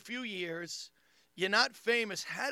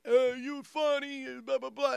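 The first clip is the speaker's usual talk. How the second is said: higher, slow gushing fan voice; mock fan; 7 semitones higher, 4 dB louder than usual. Comic imitating a bar fan, gushing mock fan. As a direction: gush like a starstruck stranger. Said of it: "The guy was like".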